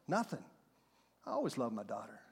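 put-on quiet old man.

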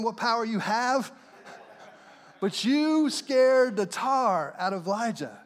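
normal talking voice

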